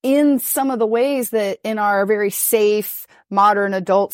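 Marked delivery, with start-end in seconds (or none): none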